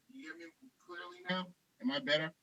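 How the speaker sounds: faint and breaking up on the line; voice going in and out. Real, not acted. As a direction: signal dropping.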